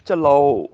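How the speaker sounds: ਚਲਾਓ stressed and complete; correct pronunciation. Shown as a demonstration. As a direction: land firmly on ਚਲਾਓ, every syllable clear. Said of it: "ਚਲੋ ਨਹੀਂ ਬੋਲਣਾ".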